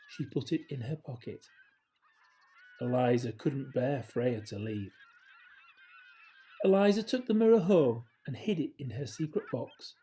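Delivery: plain reading voice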